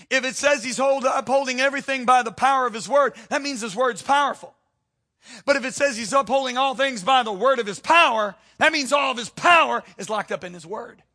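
loud, high, driving sermon climax; fiery preacher voice; preaching at full force. Acted. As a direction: hammer it home to the congregation.

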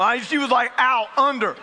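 usual speaking voice

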